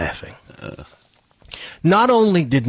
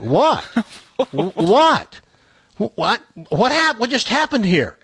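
rising bewildered stammer, climbing higher with each what; baffled what; 7 semitones higher than usual. Retold or imitated. Comedian to silent dinner guests after his joke flops, baffled rising stammer. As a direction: stammer out the whats, more lost each time. Stunned by table's silent reaction.

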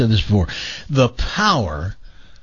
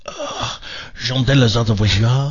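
a mock groan, then French in a slow, heavy accent; hammed-up hurting French hockey player; pained French groan; 3 semitones lower, slower than usual. Radio host mocking the injured player in a slow, pained French voice. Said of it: "did he say something like".

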